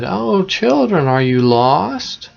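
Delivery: high, drawn-out sugary witch voice; sweetly put-on politeness, rising into the question; falsely polite. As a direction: coo it slowly, starting high, like a witch feigning concern. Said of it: "she acted polite and said".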